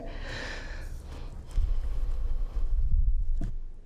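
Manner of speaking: breathy little laugh; a quiet unvoiced chuckle; soft laugh. Real, not acted. Laughs after asking for video.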